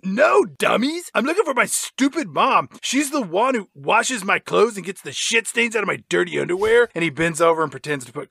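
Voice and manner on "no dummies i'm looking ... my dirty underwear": put-on exasperated, higher-pitched kid act; Milo. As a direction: explain impatiently to complete idiots. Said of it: "then Milo says".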